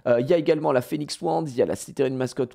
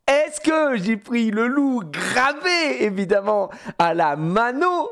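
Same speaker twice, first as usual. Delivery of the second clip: teasing; 10 semitones higher, 5 dB louder, slower than usual. Acted.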